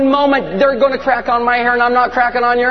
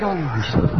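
low, subdued aside to a father; dismayed prayer; 7 semitones lower, 7 dB quieter than usual. Acted. Jesus questions the Father about entrusting Peter, low dismay. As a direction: groan it low, as if regretting the choice. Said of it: "He's like".

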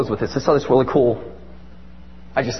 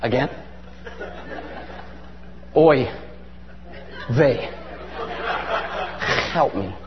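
weary exasperation; 10 semitones lower, 9 dB quieter than usual. Acted. Jesus meets Satan in the wilderness, weary exasperation. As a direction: sigh it out, fed up and resigned. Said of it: "he's like".

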